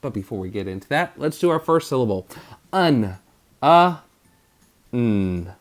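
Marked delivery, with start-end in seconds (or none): 2.7-5.5 un, uh, n split into separate sounds; sounding out un. Shown as a demonstration. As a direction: break un into its sounds one by one. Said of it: "if you put U N".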